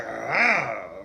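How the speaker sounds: a loud mock demon roar; anger demon roar. The anger demon inside him, a loud mock roar. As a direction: let the anger demon burst out. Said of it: "The anger demon was like".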